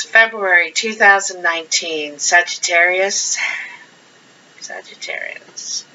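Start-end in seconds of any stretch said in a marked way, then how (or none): 4.6-5.8 breathy whispered name; hushed whisper. Acted. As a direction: whisper it like a secret. Whispered repeat of the sign.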